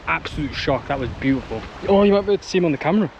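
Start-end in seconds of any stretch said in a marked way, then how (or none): 1.9-3.1 excited. Real, not acted. Excited about seeing seal.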